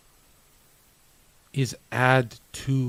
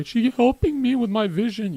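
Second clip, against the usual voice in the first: high-pitched mock quote; mocking whine; 9 semitones higher than usual. Streamer ridiculing men who share their vision with girlfriends, mocking. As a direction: mimic a besotted boyfriend in a much higher voice.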